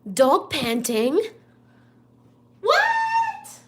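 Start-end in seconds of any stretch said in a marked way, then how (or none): 2.6-3.6 startled high what. Real, not acted. Sudden exclamation while streaming.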